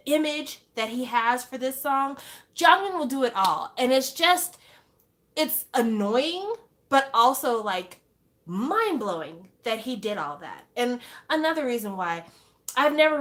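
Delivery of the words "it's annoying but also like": usual speaking voice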